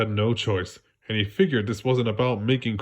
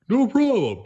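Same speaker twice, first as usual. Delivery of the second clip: high, breezy no problem; cocky reassurance from a starfish; confident; 12 semitones higher, 4 dB louder than usual. Acted. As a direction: shrug it off, sure of yourself. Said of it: "Patrick said confidently".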